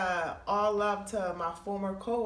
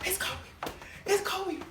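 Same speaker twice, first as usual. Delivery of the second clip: starstruck; 11 semitones higher, slower than usual. Retold or imitated. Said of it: "And I was like".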